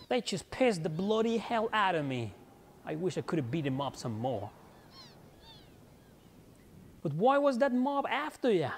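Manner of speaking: a hint of a feisty British girl; British accent. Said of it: "My so-called ridiculous British accent".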